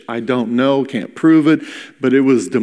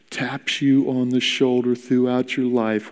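usual speaking voice

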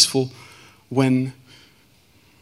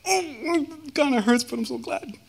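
high, strained voice of glad hurting; acted pained gladness; 12 semitones higher than usual. Man retelling when roots were torn from his heart, high pained gladness. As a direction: act out a sweet pain, pitched way up.

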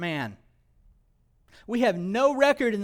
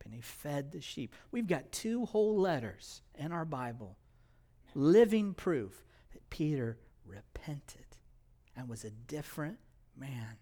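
whispered, much softer; hushed; 4 semitones lower, 9 dB quieter than usual. Real, not acted. Preacher whispering the proof of repentance to listeners. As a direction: lean in and whisper the point.

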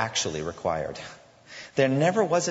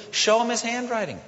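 urgent plea; 12 semitones higher than usual. Shown as a demonstration. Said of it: "we take the promises of God and we say".

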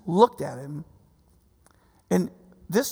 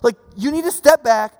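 loud, raised voice of rebuke; angry; 7 semitones higher, 7 dB louder than usual. Retold or imitated. Preacher as Jesus rebuking the disciples, angry. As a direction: imitate a forceful telling-off. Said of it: "Jesus gets really angry".

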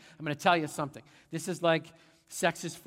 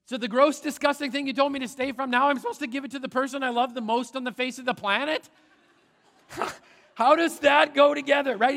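high, loud, rushed disbelief; hammed-up indignant questioning; incredulous protest; 8 semitones higher, 7 dB louder than usual. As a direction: pile it up fast, pitched high in bafflement. Acts out protest at mixed message.

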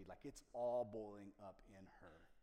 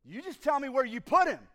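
loud, much higher shout; angry demand; 13 semitones higher, 22 dB louder than usual. Acted. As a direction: bark it at him, furious. Mary angrily confronting.

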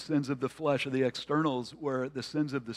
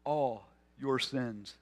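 slow, softer "All your sins"; slow and pondering; 3 semitones lower, 7 dB quieter, slower than usual. Shown as a demonstration. Preacher lets the congregation think about forgiveness, slow and soft. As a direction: let it sink in quietly, unhurried. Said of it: "invites us to just pause and think about this".

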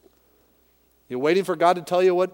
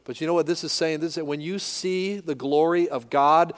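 normal talking voice

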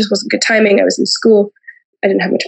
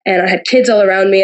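ordinary talk

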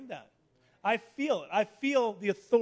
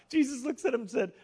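usual speaking voice

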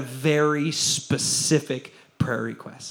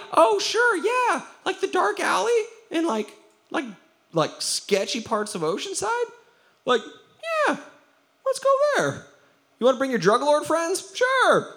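very high, sing-out sarcastic agreeableness; mock eager agreement. Agreeing to meet in a dark alley, mock eager. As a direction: agree far too readily, way up high. Skit of over-agreeing to risky meeting.

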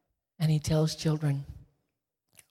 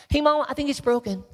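put-on high, loud excited child; a boy calling to his mom, much higher and louder; kid voice; 11 semitones higher, 8 dB louder than usual. Boy calling to Mom about the broken puppet, high and loud.

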